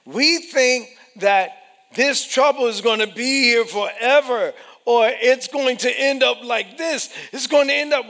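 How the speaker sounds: loud, high fervent preaching; full-force preaching. Acted. Preaching climax.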